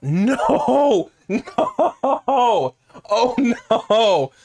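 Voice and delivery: high, loud laughing no's; breaking into laughter while protesting. Real, not acted. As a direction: laugh through the denial. Accused by game, laughing protest.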